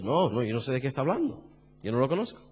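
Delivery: quick, low, soft brush-off; evasive denial. Acted. Peter again denies Jesus to his questioner, quiet evasive denial. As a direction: deny it under your voice, hurriedly. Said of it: "Simón Pedro negó a Jesús".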